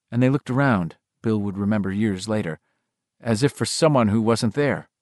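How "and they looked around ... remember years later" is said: ordinary delivery, nothing marked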